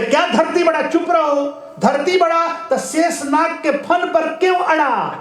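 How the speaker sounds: put-on high, insistent drunk arguing back; acted drunkard scoring a point; drunkard's retort. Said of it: "एक शराबी शराब पी लिया था".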